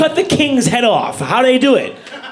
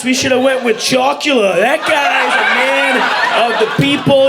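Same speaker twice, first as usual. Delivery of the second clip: higher, shouted mock outrage, slowed; hammed-up outraged ranter; 3 semitones higher, slower than usual. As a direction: rant like an online conspiracy crank. Impersonating ranting cereal poster.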